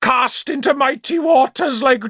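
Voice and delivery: high, sustained wailing proclamation; melodramatic shout. A narrator's reading. Bishop in muddy pond likening himself to Jonah, high shout. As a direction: proclaim your doom at high pitch. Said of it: "Sansom shouted".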